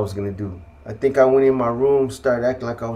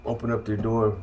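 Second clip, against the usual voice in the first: ordinary talk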